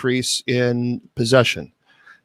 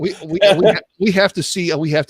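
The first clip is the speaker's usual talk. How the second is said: laughing; 5 semitones higher than usual. Real, not acted. Laughing while pointing out Kong.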